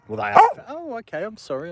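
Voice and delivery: surprised. Real, not acted. Dog interrupts, she reacts.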